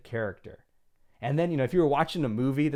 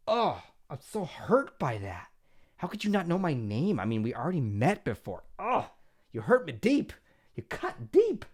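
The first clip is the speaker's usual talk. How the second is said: melodramatic wounded friend, climbing much higher; hammed-up hurt friend; 5 semitones higher than usual. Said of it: "imagining so-and-so being".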